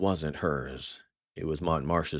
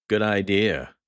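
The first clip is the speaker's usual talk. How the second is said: venomous retort; 4 semitones higher, 6 dB louder than usual. Acted. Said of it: "his voice laced with venom".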